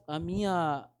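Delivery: normal talking voice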